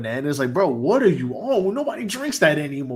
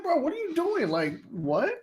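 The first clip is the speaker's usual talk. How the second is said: disbelief; 11 semitones higher, 4 dB quieter than usual. Retold or imitated. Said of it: "I'm like".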